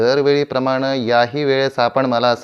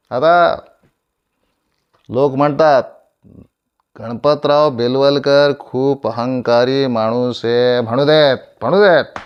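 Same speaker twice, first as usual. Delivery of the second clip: loud, raised scornful declamation; defiant; 2 semitones higher, 8 dB louder than usual. Acted. Actor brushing off people who call him arrogant, loudly defiant. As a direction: throw it back louder, building to the end.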